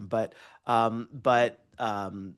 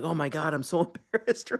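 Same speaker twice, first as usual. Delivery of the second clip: laughing embarrassment; 5 semitones higher than usual. Retold or imitated. Acts out own childhood embarrassment, laughing.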